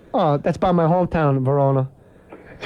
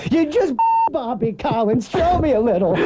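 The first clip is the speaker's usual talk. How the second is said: Andrew Dice Clay; 6 semitones higher than usual. Retold or imitated. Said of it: "he's on stage, then ten minutes in".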